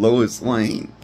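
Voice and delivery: normal talking voice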